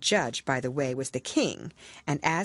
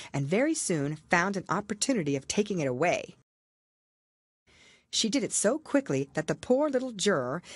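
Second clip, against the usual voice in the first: plain reading voice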